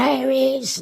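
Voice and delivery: high-pitched, re-enacted call on spotting someone; mock excited call. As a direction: call it out high, playing up the moment. Retelling calling out on spotting prince.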